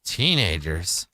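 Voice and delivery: slow, measured storyteller with long pauses; wry story narration. Acted. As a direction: recount it like a drawn-out tale.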